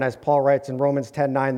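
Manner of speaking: ordinary talk, nothing marked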